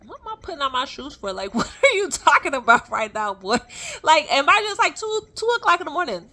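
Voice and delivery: high, loud incredulous outburst; baffled, half-laughing disbelief at the wake-up; disbelieving. Retold or imitated. Incredulous at being woken at 2am.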